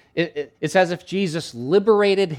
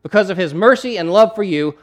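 loud, high, forceful declaration; proclaiming to deaf man; 6 semitones higher, 8 dB louder than usual. Acted. Preacher plays Jesus crediting God's power, loud proclamation. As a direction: proclaim it with raised, emphatic voice. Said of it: "and he said".

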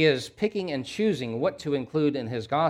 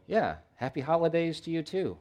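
higher, offhand holiday greeting; easy way out; 4 semitones higher than usual. Acted. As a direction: toss it off as a polite, easy reply. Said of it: "so easy for us as Christians to go".

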